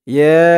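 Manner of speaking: ইয়া with a very long held vowel; three-alif stretch. Shown as a demonstration. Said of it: "এখানে তিন আলিফ টান হবে". (ইয়া লম্বা টেনে উচ্চারণ)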